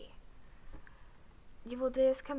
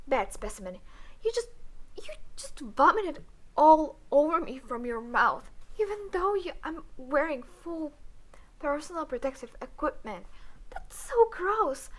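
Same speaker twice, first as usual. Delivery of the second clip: loud and high, climbing into revulsion; disgusted outburst; 5 semitones higher, 7 dB louder than usual. Acted. Alien doctor disgusted at specimen who vomited on her. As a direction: rant at him, louder with each line.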